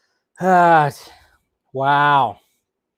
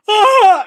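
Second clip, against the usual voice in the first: high, loud shriek of pretend horror; mock scream; 9 dB louder than usual. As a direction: recoil with a playful loud scream. Mock horror at own bare head.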